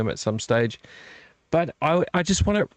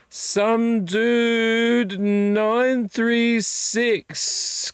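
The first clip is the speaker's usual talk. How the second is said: drawn-out high announcer call of the name; very slow, high hype announcement; announcer read; 10 semitones higher, much slower than usual. Real, not acted. Reading chat username aloud.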